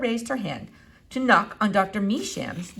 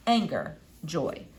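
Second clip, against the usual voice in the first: plain reading voice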